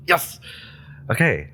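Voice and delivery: ordinary talk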